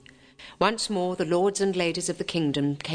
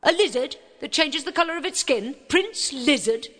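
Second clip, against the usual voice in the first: cook voice; 9 semitones higher, 3 dB louder than usual. A narrator's reading. Cook keeps complaining.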